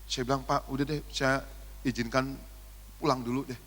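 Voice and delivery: ordinary talk, nothing marked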